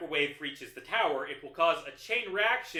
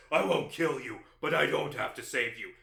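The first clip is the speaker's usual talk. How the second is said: Batman voice. Retold or imitated. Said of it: "Batman says".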